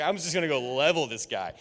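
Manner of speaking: normal talking voice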